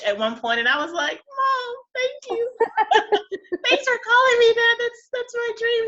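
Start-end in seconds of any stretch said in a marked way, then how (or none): 1.4-5.9 beam with delight; delighted thanks. Retold or imitated. Thanking her mom, much higher and laughing with joy.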